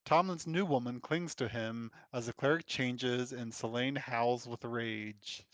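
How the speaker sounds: ordinary delivery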